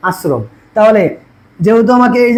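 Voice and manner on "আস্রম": আশ্রম lower and softer, thin "s", "asrom"; correct light s. Shown as a demonstration. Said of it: "কি করি".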